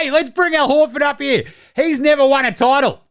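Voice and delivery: high-pitched hammed-up presenter; mock presenter. Mock presenter calling a player up at the trophy presentation, hammed-up. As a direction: announce it high, mock-jolly to a crowd.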